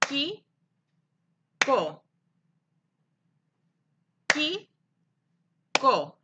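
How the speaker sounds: "Kiko" broken as Ki, long pause, ko; rising first beat and falling second in "Kiko"; clapped syllables. Shown as a demonstration. Teacher claps for pupils, "Kiko" one syllable per clap. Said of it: "una palmada al escuchar cada sílaba".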